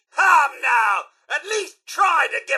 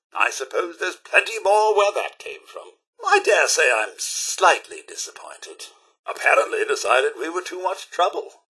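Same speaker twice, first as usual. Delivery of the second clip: mild disappointment; 8 semitones lower than usual. Acted. Enemy has gone away.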